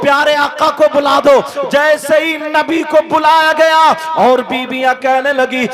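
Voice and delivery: loud, driving sermon at full force; fiery preaching. Acted. Preacher tells the crowd of the Prophet's kindness, fiery full-force preaching. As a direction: preach it out with fervour.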